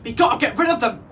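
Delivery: rally the flock; announcing. A narrator's reading. Female parrot rallying the flock, urgent announcement. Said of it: "announced the female parrot".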